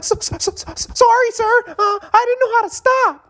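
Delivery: put-on very high, stammering little-kid voice; scared kid. A kid apologizing to the skier he knocked over, very high. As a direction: squeak out a panicked kid's apology. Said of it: "I'm a kid".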